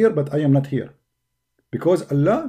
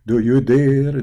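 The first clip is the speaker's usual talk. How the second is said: usual speaking voice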